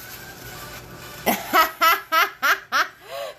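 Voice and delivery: helpless, rising peals of laughter; long laughing fit. Real, not acted. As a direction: crack up completely. Amused by someone in the class.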